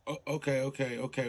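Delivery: ordinary delivery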